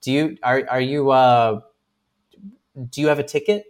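hesitant, mildly nervous mimicry; nervous hesitation. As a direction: imitate a nervous official stalling between words. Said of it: "nervous, like, kind of, like, glancing".

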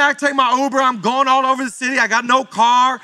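usual speaking voice

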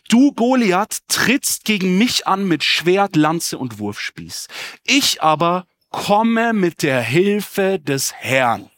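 slow, emphatic declamation, starting high and loud; a measured, defiant call to an opponent; David calling back. A narrator's reading. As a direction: proclaim it slowly, word by word. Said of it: "Doch David rief zurück".

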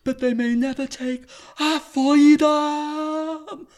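high, slow, hammed-up Scottish William Wallace rallying cry; mock Scottish battle cry. Joking as William Wallace to his cohost, a slow, high bad Scottish accent. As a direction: stretch it out like a Braveheart speech in a bad Scottish accent. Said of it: "you can't do a Scottish accent at all".

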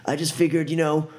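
high, stammering excuses; flustered pleading. Acted. Roughed-up driver pleading with thug, high and flustered. Jerry pleading while shoved around.

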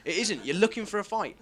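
ordinary delivery

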